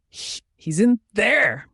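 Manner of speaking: high, slow, frightened stammer; stuttering in fear. A narrator's reading. Doctor stammers in fear to the furious sister, high and slow. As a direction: get the words out through terror. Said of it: "he stuttered out in fear".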